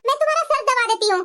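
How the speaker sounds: ordinary talk, nothing marked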